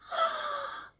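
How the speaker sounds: squeaky high gasp of envy. Acted. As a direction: gasp in jealous delight. Starstruck again.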